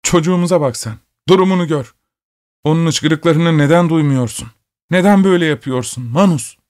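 high, desperate pleading at the husband; high-pitched frantic plea. A narrator's reading. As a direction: beg him to see the child, distraught. Mother screams at the father.

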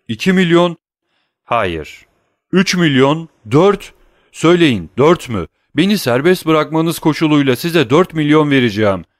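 high, loud and urgent offers; rising frantic bidding. A narrator's reading. Danglars bidding millions to Vampa, frantic. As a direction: bargain desperately for freedom. Prisoner bids higher and higher.

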